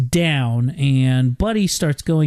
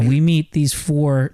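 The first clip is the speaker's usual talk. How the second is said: normal talking voice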